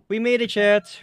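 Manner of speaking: loud, high celebration; triumphant cheer. Real, not acted. Streamer telling chat they reached floor three hundred, triumphant. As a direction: cheer it out to your viewers.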